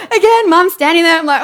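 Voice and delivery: ordinary delivery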